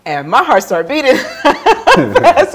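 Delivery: a nervous giggle breaking into the line; laughing nervously. Real, not acted. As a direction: laugh out the fright you felt. Recalling the police officer's approach.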